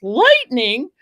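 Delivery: the penny drops, loud; delayed alarm. Retold or imitated. Englishman realising it was lightning, alarmed.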